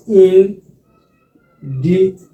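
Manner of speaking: independence said very slowly; slow drill. Shown as a demonstration. Teacher drilling a long word with the class, very slow. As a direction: stretch independence out syllable by syllable. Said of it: "Waxaa kala isticmaali karnaa".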